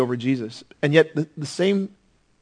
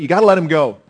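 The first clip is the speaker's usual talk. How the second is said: louder, pressing plea; wife's insistent plea; 3 semitones higher, 7 dB louder, faster than usual. Retold or imitated. Wife urging Pilate to free Jesus, loud insistent plea. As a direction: insist he let him go. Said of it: "She says".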